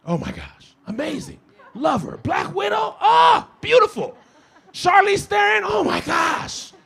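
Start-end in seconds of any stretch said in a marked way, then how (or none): 2.2-6.7 excited gushing. Real, not acted. Excited gushing over actresses.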